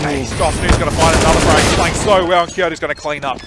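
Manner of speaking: loud, rising esports commentary; hyped match caster; excited play-by-play. Real, not acted. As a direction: call the frag with loud excitement.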